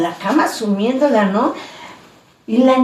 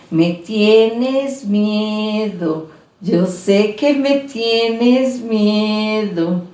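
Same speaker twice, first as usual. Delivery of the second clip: voz lenta y arrastrada de niña espectral; niña fantasma; much slower than usual. Acted. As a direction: susurra la amenaza muy despacio. Niña fantasma repite su amenaza. (niña fantasma que se burla lentamente)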